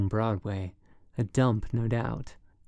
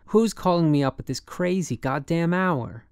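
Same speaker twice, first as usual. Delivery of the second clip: higher, indignant demand; irritated woman; 6 semitones higher, 4 dB louder than usual. A narrator's reading. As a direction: snap at a late-night caller. Woken woman snaps at caller.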